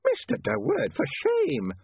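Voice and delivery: very high, scandalised landlady. A narrator's reading. Landlady rebuking her lodger for swearing, scandalised. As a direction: reprove him in a shocked high voice.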